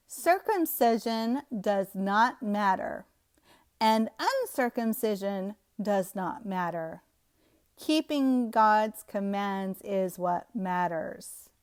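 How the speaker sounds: measured, drawn-out verse reading; slow deliberate reading. Shown as a demonstration. Preacher rereading a verse to expose its flip-flop, slow and deliberate. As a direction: read it slowly, word by word. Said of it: "I'm gonna read it slower".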